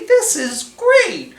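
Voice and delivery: high, delighted little voice; excited tiny character. Acted. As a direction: marvel at the shop window. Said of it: "thought Itty Bitty".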